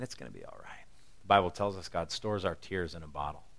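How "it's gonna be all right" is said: very soft, low hush of comfort; tender whisper. Pretend. The Son of God soothing the weeping, soft and low. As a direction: breathe it gently like soothing a child. God's thumb wiping tears.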